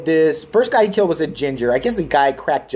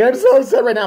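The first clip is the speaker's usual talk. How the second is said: put-on higher, louder whiny child; whiny kid; 7 semitones higher, 4 dB louder than usual. A son whining to his dad about the show, a high whine. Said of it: "if my kid came to me like".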